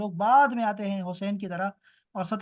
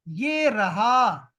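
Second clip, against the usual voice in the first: "یہ رہا" drawn out slowly and louder; slow teaching repeat; 5 dB louder, much slower than usual. Shown as a demonstration. Teacher repeating the meaning for students, slow and emphatic. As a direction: stretch both words of "یہ رہا" for learners.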